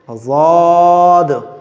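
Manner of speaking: "ज़ाद" heavy, with a long held vowel; pronunciation demo. Shown as a demonstration. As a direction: stretch "ज़ाद" out on a level tone. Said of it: "इस तरह से". ("ज़ाद" को खींचकर मोटा पढ़ें)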